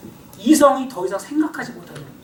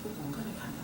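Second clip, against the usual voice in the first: fast, much softer self-doubting mutter; 8 semitones lower, 19 dB quieter, faster than usual. Acted. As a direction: rattle off the doubts under your breath. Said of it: "또 막 이성이".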